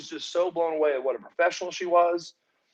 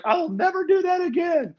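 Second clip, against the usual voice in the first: lightly voiced woman, much higher; mock frightened Goldilocks; 13 semitones higher than usual. Goldilocks stammering to him after the fight, high voice. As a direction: pitch way up as the scared wrestler.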